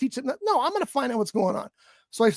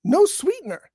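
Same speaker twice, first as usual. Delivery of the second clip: real indignant emphasis; 7 semitones higher than usual. Real, not acted. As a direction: hammer each phrase home in exasperation. Indignant answer to "Seriously?.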